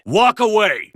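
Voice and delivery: high, loud command; shouted order. Acted. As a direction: bark the order.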